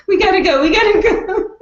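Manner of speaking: high, laughing urgent mimic; mock panic, hurry them out; laughing imitation of raiders rushing; urgent quote. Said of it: "when they're like".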